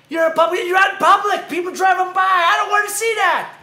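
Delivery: loud, high-pitched outraged rant; exasperated scolding. Acted. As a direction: shout it at an offender in disbelief. Ranting at someone eating in public.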